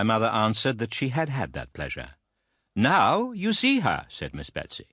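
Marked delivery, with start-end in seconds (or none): 2.7-4.1 high, slow and imperious; grand announcement. A narrator's reading. Aunt announcing herself to the widow, slow and grand. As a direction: present yourself like royalty. Miss Betsy presents herself.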